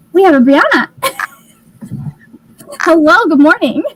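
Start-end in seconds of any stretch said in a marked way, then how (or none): none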